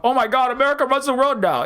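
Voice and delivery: a touch of mock doom from a worried pundit; mock panicked commentator. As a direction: lightly ham up the alarmed commentator. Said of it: "just going off".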